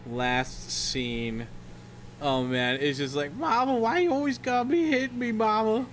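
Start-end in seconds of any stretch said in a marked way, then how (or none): 3.3-5.9 high, whiny imitation sob; hammed-up tearful whine; mock crying. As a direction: plead like a bawling grown son. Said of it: "to s-, to crying".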